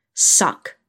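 short, forceful one-word command; demanding. A narrator's reading. Narrator voices a man ordering his lover, demanding. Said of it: "he demands".

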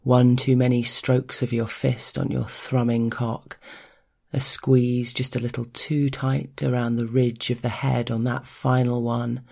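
ordinary delivery, nothing marked

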